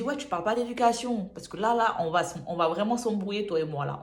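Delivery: angry comeback. Retold or imitated. Answering the roommate back, loud and heated. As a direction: snap back, ready for a fight.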